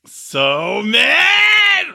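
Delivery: high, loud teasing yell; playful loud outburst; mock angry shout. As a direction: shout it like a mad friend. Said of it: "Rico can get so mad".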